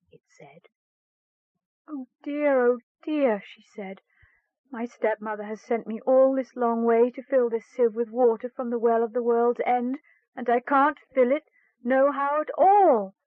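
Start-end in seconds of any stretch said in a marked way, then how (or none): 1.9-3.4 high, distressed little cry of woe; girl's lament. Acted. Girl laments at the well. 4.7-13.1 higher, rising to a louder plea; girl's lament. Acted. Girl explains her plight tearfully.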